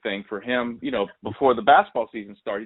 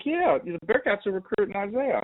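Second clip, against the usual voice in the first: lightly voiced mom, pitched higher; a higher, chatty mom voice; mom's voice; 10 semitones higher than usual. Mom tells him Bearcats recruit her son, lightly voiced higher.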